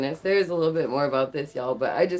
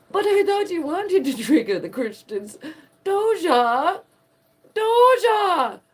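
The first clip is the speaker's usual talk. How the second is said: high mocking call of her name, much higher and louder; mocking sing-song; 12 semitones higher, 5 dB louder, slower than usual. Vlogger teasing [name] by calling her name in a high mocking voice.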